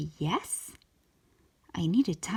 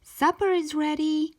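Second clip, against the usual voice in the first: calling out; 7 semitones higher, 4 dB louder than usual. A narrator's reading. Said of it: "yelled Mom".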